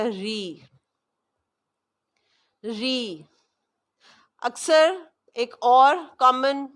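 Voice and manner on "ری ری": ری said light and thin, softly; thin Quranic ری. Shown as a demonstration. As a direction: keep ری fine and narrow. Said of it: "اس کو باریک پڑھا جائے گا".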